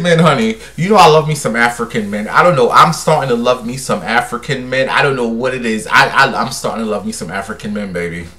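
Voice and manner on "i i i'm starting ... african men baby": normal talking voice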